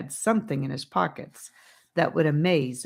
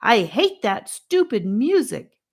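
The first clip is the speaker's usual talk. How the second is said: frustrated outburst; 6 semitones higher, 6 dB louder than usual. A narrator's reading. Boy frustrated in cave.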